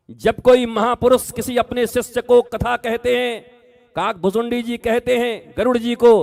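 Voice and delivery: high, loud impassioned sermon; preaching at full force. Acted. Preacher warning the devotees, high and loud. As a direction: hammer the warning home to the crowd.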